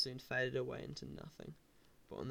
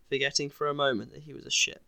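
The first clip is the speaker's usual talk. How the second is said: usual speaking voice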